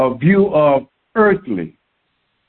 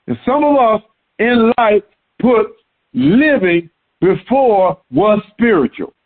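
normal talking voice

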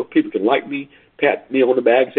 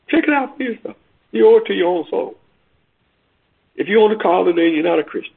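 full-force preaching; 8 semitones higher than usual. Acted. Preacher urging listeners on the call, full-force cry. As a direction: urge them hard to go read it.

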